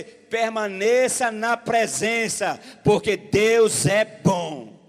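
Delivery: booming, slow proclamation; preaching at full force. Real, not acted. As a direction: proclaim it to the church, loud and slow. (pregação em voz forte e lenta)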